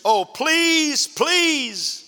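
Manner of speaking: high, begging please please; pleading. Acted. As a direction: beg like a lovesick boy. Boy begging for a kiss.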